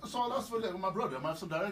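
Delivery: exaggerated Nigerian accent demo. Shown as a demonstration. Host asking for directions in Nigeria, Nigerian accent. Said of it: "In Nigeria, my accent changes".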